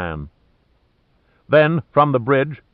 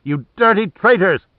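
shrill furious yell, much higher; exploding in rage; 8 semitones higher, 4 dB louder than usual. Acted. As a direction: spit the insult out in fury. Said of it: "he exploded".

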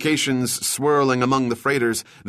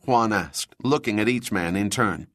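ordinary delivery, nothing marked

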